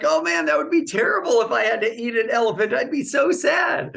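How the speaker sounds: imitating a student's high, loud tearful wail; mock crying. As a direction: whine it high and loud, slowing at the end.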